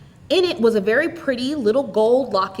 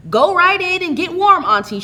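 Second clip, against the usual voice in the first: high and louder, quick and practical; brisk; 10 semitones higher, 6 dB louder than usual. A narrator's reading. Theodora sending her tired aunt in from the cold, brisk. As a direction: hurry her inside, all business. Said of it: "she said briskly".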